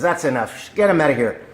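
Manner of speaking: lightly acted irate boss; fed-up talent agent. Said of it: "throws a cigar down and he says".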